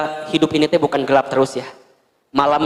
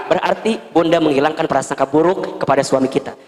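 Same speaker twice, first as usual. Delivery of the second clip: ordinary delivery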